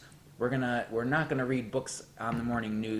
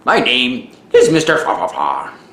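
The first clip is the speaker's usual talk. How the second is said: booming goofy host voice; silly announcer; 2 semitones higher, 17 dB louder than usual. Acted. Mister Fa Fa Fa intro.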